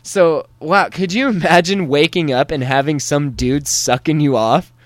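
ordinary talk

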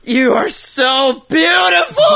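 high, slow shout of self-adoration; hammed-up vain cry at his reflection; mock adoring shout. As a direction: bellow it at the mirror, slow and drawn out. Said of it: "I'm like".

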